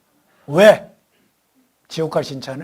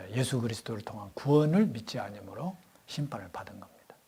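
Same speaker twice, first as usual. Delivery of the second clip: hushed, intimate whisper; whispered point; 4 semitones lower, 8 dB quieter than usual. Acted. Preacher whispers the key truth to the congregation. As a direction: drop to a whisper to drive it home.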